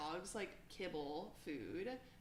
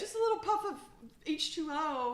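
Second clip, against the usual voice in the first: put-on much higher, louder baby talk; cooing; 9 semitones higher, 8 dB louder than usual. Girl baby-talking the fish back to life, high coo. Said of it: "I'm like".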